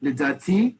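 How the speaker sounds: LIDATI lifted at the end; humble rising tone. Shown as a demonstration. Teacher showing humility without saying it, LIDATI rising at the end. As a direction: say LIDATI with a tentative upward turn. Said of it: "show humility without saying it".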